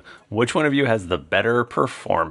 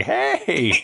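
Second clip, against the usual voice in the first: raised, drawn-out call of protest; indignant cry; 5 semitones higher than usual. Acted. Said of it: "Toby's like".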